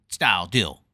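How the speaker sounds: normal talking voice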